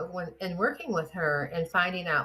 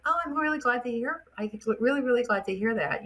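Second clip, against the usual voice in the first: high, pleased oh, mock-gushing; light, lifted surprise at good news; delighted surprise; 6 semitones higher than usual. To the grateful husband, high delighted surprise.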